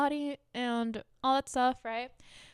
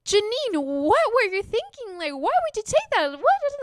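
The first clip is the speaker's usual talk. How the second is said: much higher, louder frantic scolding; frantic mother yelling at her daughter; mom going off; 12 semitones higher, 6 dB louder than usual. Retold or imitated. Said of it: "she just starts going off on me".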